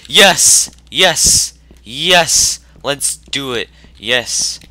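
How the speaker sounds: very high, loud triumphant yells; victory cheer. Real, not acted. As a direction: scream in triumph. Found the last item.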